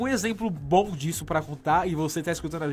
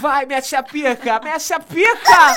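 slow, louder and higher moaning yells; loud lusty cries; 7 semitones higher, 12 dB louder, slower than usual. Acted. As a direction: scream it in mock passion. Acted sex cries.

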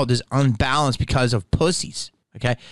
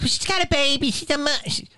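high-pitched mock protest; imitating defenders; 10 semitones higher, faster than usual. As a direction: mimic people making excuses, pitched way up. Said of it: "And they're like".

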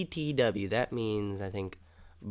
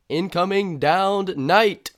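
announcing; 7 semitones higher, 9 dB louder, slower than usual. Acted. Announcing a joke acronym.

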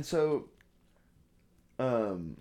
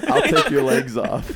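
loud, higher, laughing threat; mock threat; 4 semitones higher, 10 dB louder than usual. Knight calling after them, hammed-up loud threat. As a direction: yell it through laughter.

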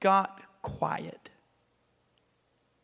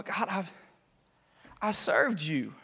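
softer, hesitant plea to God; changed, subdued tone; 5 dB quieter than usual. Acted. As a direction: plead quietly, no longer shouting. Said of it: "And the tone changes".

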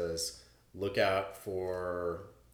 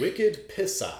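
Maine phrase; 9 semitones higher, 5 dB louder, slower than usual. Shown as a demonstration. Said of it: "What does that mean?".

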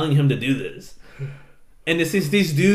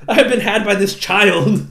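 loud, higher theatrical lament; mock indignation; 7 semitones higher, 6 dB louder than usual. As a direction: declaim it as the wronged hero, amused. Betrayed pilot declaims.

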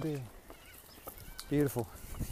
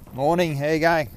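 louder, friendly passing hello, ending in a fall; greeting a neighbour; 7 dB louder than usual. Real, not acted. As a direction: call out a cheery good morning to a neighbour on a walk. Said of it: "now you know what I sound like when I say hello".

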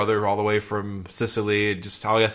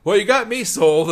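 higher, louder mock senator; senator won over; 11 semitones higher, 11 dB louder than usual. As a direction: blurt it out loud and high as the senator. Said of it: "has the senator just like".

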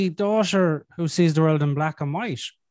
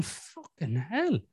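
muttered fucking hell; 9 dB quieter, much slower than usual. Retold or imitated. Exasperated inner reaction.

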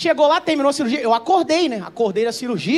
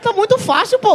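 high-pitched offended protest; mock indignation; 8 semitones higher, 4 dB louder than usual. Patient protesting nurse's handling, mock indignant. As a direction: complain high, playing the wronged party.

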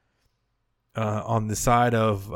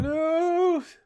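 much higher hammed-up announcer voice; announcing big news; 3 dB louder than usual. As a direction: sing it out like a show jingle. Echoing jingle for the news.

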